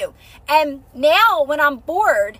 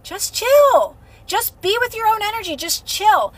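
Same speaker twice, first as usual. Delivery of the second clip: inner guidance voice; 7 semitones higher than usual. Retold or imitated. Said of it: "I always hear, like".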